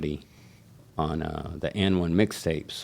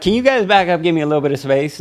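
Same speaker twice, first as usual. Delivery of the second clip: much higher, louder call for room; nervous loud plea; 11 semitones higher, 7 dB louder, faster than usual. Retold or imitated. Shaking barber asking the crowd to back up, much louder. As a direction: shout for space over a crowd.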